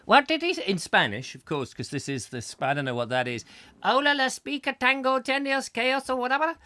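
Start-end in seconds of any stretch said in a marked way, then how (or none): 0.0-0.6 high, loud cupped-ear asking; high mock-deaf question; deaf old spectators. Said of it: "hearing aids going". 3.8-6.5 high, hammed-up fake Spanish gibberish; comic Spanish accent, pitched up; mock Spanish accent. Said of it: "I gave them that strange accent".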